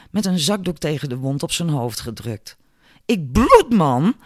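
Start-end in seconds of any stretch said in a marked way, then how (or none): 3.1-4.2 loud, high complaint; crying out. A narrator's reading. Albert calls to his friends, loud and aggrieved. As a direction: call out in pained indignation. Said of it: "riep hij tegen zijn vrienden".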